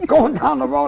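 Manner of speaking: ordinary delivery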